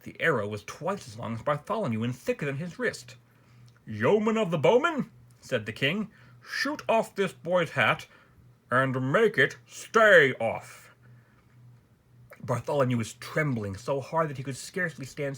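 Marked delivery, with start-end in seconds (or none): 3.9-5.1 high, loud royal summons; commanding king. A narrator's reading. King gives order to bowman. 6.4-11.0 slow, weighty royal order; commanding king. A narrator's reading. King gives order to bowman.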